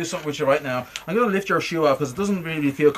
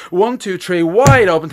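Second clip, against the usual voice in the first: much louder count to wake; 6 semitones higher, 8 dB louder, much slower than usual. Real, not acted. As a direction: snap them awake on three. Waking command.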